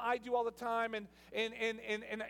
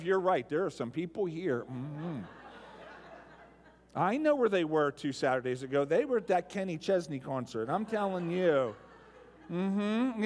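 lower, gossipy knowing churchgoer; hint of a self-righteous church member; mocking a judgy churchgoer; 6 semitones lower, 4 dB louder than usual. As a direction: play the smug pew gossip, lightly. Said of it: "We all think in terms of".

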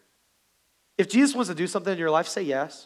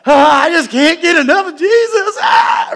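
shrieking high and loud with joy; over-the-top church kid yelling; oversaved fan; 13 semitones higher, 14 dB louder than usual. Acted. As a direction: scream it out like a superfan. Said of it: "you're like".

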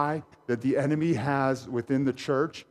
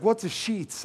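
higher-pitched mock Spanish accent; 8 semitones higher, slower than usual. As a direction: imitate a puzzled visitor with a Spanish accent. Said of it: "with your Spanish accent".

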